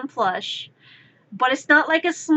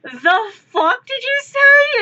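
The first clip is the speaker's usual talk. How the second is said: high, slow and louder, imitating shock; hammed-up indignant girlfriend; mock outrage from the doorway; outraged disbelief; 9 semitones higher, 5 dB louder, slower than usual. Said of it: "and goes".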